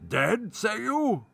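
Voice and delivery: high, slow theatrical baron; hammy stage actor. A narrator's reading. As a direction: draw it out like a stage villain. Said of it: "smile shot over the countenance of the listener".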